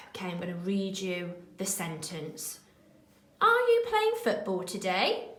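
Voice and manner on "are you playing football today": much higher, the pitch climbing on the question; rising question. Shown as a demonstration. Teacher reading a quiz question to the children, rising high. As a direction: ask it brightly with a big lift at the end. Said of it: "it goes slightly higher at the end".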